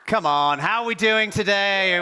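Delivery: loud, high, drawn-out "doing today"; rousing crowd call. Real, not acted. Speaker demanding a bigger response from the audience, rousing call. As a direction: pump up the audience with a big, stretched call. Come on.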